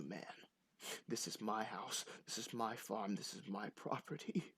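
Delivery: soft, measured threat; menacing grieving farmer. Acted. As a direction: warn intruders off in a hushed voice. Game master plays threatening farmer.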